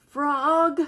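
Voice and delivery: Toad calling Frog. Acted. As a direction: call out to Frog a little upset. Toad calls his friend.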